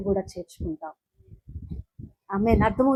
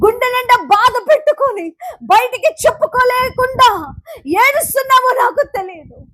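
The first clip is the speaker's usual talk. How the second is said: loud, raised preaching; preaching at full force; 12 semitones higher, 8 dB louder than usual. Acted. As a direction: drive the promise home to the crowd. Building preaching.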